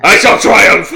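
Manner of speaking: loud, proud villain boast; triumphant boast. Acted. GM voicing the paladin, a loud triumphant boast. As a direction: crow it like a triumphant knight.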